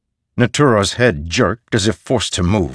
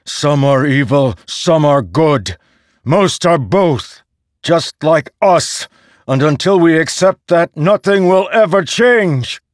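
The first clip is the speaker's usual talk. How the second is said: loud, high impassioned plea; 8 semitones higher, 5 dB louder than usual. Acted. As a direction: plead with full force. Rlain's impassioned appeal.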